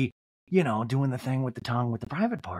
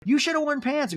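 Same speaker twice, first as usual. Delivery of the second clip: much higher mock scolding; improvised scold, pitched way up; Crystal; 12 semitones higher than usual. Crystal telling Garcelle off, high mock reproach. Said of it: "I don't have a Crystal voice".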